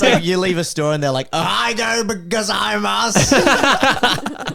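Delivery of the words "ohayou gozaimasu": high, very drawn-out Aussie mangling of Japanese; butchered Japanese, Aussie accent. Retold or imitated. Parents leaving a Japanese store, hammed-up Aussie-accented butchering. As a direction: stretch each syllable, hammed-up Australian tourist Japanese. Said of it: "just butcher them".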